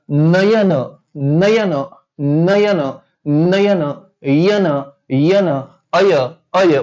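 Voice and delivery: "नयन" drawn out slowly to bring out "अय"; demonstrated pronunciation. Shown as a demonstration. Teacher sounding out the word for students, slow demonstrative "नयन". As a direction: repeat "नयन", then peel it down to "यन" and "अय". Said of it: "त्या शब्दांचा उच्चार केला जातो".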